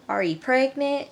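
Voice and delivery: mock Southern mom firing questions; country mom. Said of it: "Mom was like".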